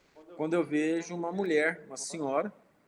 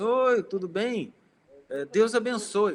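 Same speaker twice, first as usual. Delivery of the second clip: bright-pitched, drawn-out friendly hello and blessing; cheerful greeting; 5 semitones higher, 6 dB louder, slower than usual. Retold or imitated. Speaker greets two tense women in a supermarket, cheerful and slow. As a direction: greet them openly, higher, louder, unhurried.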